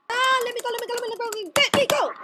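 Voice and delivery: high, rapid-fire repeated plea; trapped, high-pitched begging; frantic pleading. Real, not acted. Caught in net, struggling.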